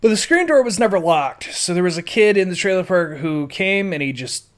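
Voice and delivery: usual speaking voice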